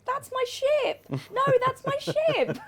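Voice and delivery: high, slow mock pleading wail; imitating her panic. Host voicing the streamer's ship panic, high mock wail. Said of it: "'Cause you guys can't hear her, she's going".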